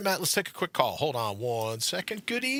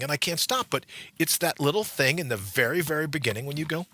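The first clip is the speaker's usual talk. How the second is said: ordinary talk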